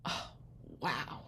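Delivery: quiet, low, deadpan wow; feigned wow that sinks away; underwhelmed reaction. Retold or imitated. Acts out underwhelmed reply to parents.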